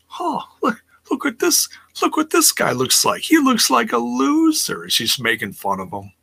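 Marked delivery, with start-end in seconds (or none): 0.1-4.8 high, mocking taunting voice; mocking tease. Wrestler mocking a rival, high taunting voice. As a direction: sneer at him like a schoolyard bully. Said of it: "starts teasing him like".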